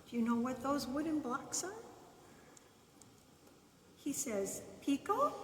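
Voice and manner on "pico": calling the dog. Acted. Keith calling the dog gently.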